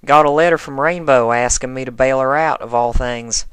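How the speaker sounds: amused and bemused, drawn out; farmer's drawl. A narrator's reading. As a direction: Applejack telling Rarity about the letter, drawling. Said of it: "drawled the farmer".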